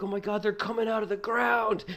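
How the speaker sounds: very high, loud alarm; hammed-up high-pitched battle panic; retold frantic yell. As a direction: shout a panicked warning to your brother. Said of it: "I yelled at my brother".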